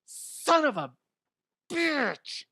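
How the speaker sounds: high strained half-yelled curse; mock pained curse. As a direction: swear as the toe hits. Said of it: "me going".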